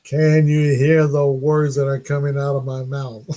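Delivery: mock-careful, drawn-out words; exaggerated slow, level enunciation; slow, clear, loud. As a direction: stretch it out slowly as if for someone hard of hearing. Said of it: "slowly, clearly, and loud".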